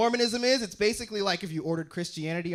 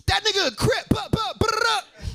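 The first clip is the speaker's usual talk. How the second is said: much higher, louder gangster yelp; 13 semitones higher, 5 dB louder than usual. Retold or imitated. Comic voicing a Blood spotting a Crip, high loud yelp. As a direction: bark it out like a hyped gang member. Gang member checking rival.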